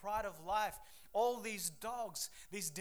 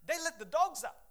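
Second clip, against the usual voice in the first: ordinary talk, nothing marked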